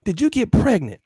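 put-on puffed-up boyfriend snapping back; angry Joseph. Joseph confronting Percy about Mary, mock angry. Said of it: "he get mad".

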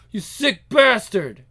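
slow, loud curse; outraged. Acted. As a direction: spit out anguished outrage. Father threatened through daughters.

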